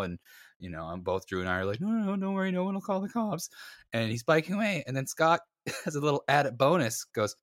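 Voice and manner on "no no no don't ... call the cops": high-pitched hasty reassurance; squeaky mock appeasement; anxious placating. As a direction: imitate nervously soothing someone dangerous. Placating the departing mugger.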